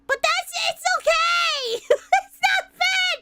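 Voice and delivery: high-pitched mock protest; flustered defensive yell. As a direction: insist loudly, raising the pitch in playful panic.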